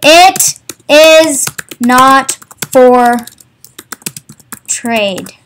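each word spaced out, very slow and loud; slow emphatic. Real, not acted. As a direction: drag out it is not for trade to make the point. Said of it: "Enough of that rant".

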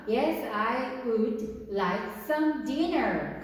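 very slow, every word drawn out; slow classroom answer; slow model answer. Shown as a demonstration. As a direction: spell it out slowly for the class. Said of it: "이 얘기는요".

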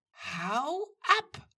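high, loud, sharp dismissal; drawn, high-pitched angry hiss. A narrator's reading. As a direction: send someone away fiercely. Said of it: "zischte Tamara".

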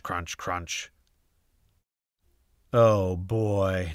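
ordinary delivery, nothing marked

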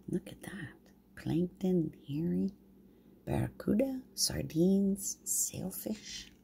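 soft hushed pointing out; whispered aside. Real, not acted. Grandma pointing at the pictures for the babies, whispered. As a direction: whisper it like sharing a secret.

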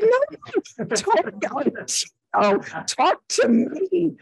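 high, hammed-up wail of complaint; indignant protest. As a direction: re-enact a whiny, outraged protest way up high. Retells protesting to the teacher.

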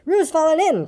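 high cry of alarm. A narrator's reading. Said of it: "cried Rabbit".

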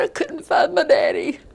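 real sob through the words; crying. Real, not acted. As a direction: voice breaking into tears, high and strained. Retold child's words, crying.

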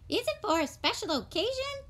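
high, quick shop assistant; a polite high-voiced shopkeeper. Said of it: "asked the shop assistant".

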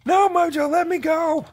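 high, loud cry to be let go; a kid playing a captive, shouting at the monkey to let go; pleading shout. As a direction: scream at the monkey to let you go. Pretend captive shouting at Mojo.

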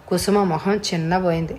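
ordinary delivery, nothing marked

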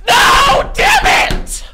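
high screaming frustrated outburst. Real, not acted. Nearly breaking the enemy bed, real frustrated scream.

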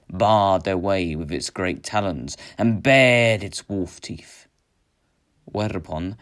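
plain reading voice

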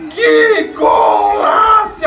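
high, slow drawn-out golaço; long goal scream. Real, not acted. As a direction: stretch and scream Que golaço. Celebrating goal, louder higher.

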